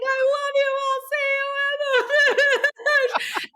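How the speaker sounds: retold sobbing goodbye to her dad mid-race. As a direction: cry it out high to dad. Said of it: "and I'm sobbing".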